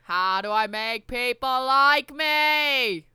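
put-on loud, very slow Kennedy accent; JFK voice. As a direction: draw it out like JFK at a podium. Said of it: "in the J F K voice".

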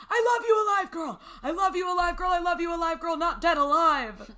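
mock shouting it high and loud; all caps shout. Reading the all-caps text aloud, mock shouting. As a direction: belt the all-caps words like a text screamed out. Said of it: "I love you all caps".

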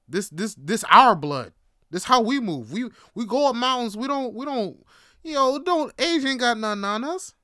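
mock-rousing family pride, voice rising; proud rallying. Rousing boast about family's strength.

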